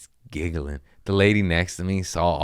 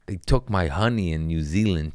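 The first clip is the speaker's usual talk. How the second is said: ordinary delivery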